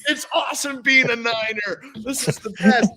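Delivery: mock delight over free steaks; thrilled rookie. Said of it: "I'm like".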